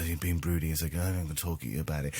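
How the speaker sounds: low, quiet brooding voice; mock a gruff brooder, low and under the breath; Karl Urban. Podcaster imitating brooding actor talking to his squadmate, low mock-broody voice. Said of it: "Karl Urban's sort of, like, going".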